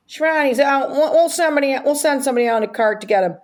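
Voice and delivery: high-pitched helpful staffer voice; golf club staffer. Retold or imitated. Golf course staff answering daughter's call, high eager voice. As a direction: imitate an eager clerk, pitched well up.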